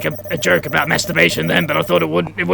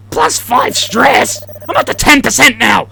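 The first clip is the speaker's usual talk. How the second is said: high, raised-voice score announcement; alarmed exclamation; 6 semitones higher, 4 dB louder than usual. Acted. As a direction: exclaim the stress jump in mock alarm. Player announces rising stress score.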